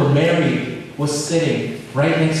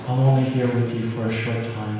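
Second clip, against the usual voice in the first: lower, softer, calm reply, faster; gentle Jesus; 7 semitones lower, 5 dB quieter, faster than usual. Retold or imitated. Jesus calmly and softly answering Martha, lower voice. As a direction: answer her gently and quietly. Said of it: "Jesus looked at her and said".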